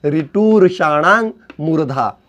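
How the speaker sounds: slow, careful sutra chant; slow recitation. Shown as a demonstration. Teacher recites the sutra slowly for students. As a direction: spell out each word of the sutra. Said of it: "teacher repeats sutra slowly".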